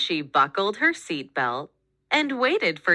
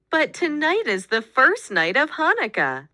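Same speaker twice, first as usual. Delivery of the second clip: higher, pleading, a touch tearful; mild sob; 7 semitones higher, 3 dB louder than usual. Acted. Girl pleads with her parents about Hanukkah night, mildly tearful. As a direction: plead with mild, understated tears. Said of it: "sobbed Rachel".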